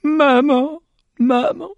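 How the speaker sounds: high, choked calls rising; sobbing. A narrator's reading. Boy sobbing for his dead mother, choked. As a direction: gasp out for mother. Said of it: "Il suffoquait en disant".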